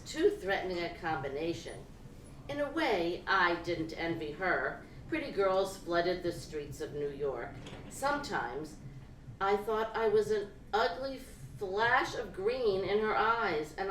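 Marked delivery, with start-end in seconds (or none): none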